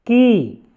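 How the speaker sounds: की drawn out on a long ee; long-ee pronunciation. Shown as a demonstration. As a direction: sound out की slowly, then let it fall. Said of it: "क पे बड़ी ई की मात्रा जब लगेगी तो इसका उच्चारण". (की, लंबी ई के साथ)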